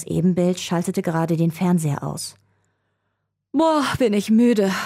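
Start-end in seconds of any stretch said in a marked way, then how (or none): 3.5-4.7 high, slow yawny voice; tired, drawn-out yawn talk. A narrator's reading. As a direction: stretch the words out through a sleepy yawn. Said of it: "gähnte ein zweiter Jacob".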